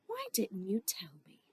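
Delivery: soft unvoiced plea; whispering. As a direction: whisper it, mock hurt. Fake-tearful plea.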